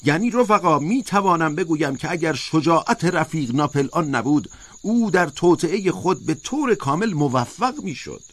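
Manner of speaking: high, emphatic protest; insistent high-pitched oration, not a full shout; crying out. A narrator's reading. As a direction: argue it high and forcefully, like a rousing speech. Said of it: "اسکویلر فریاد کشید".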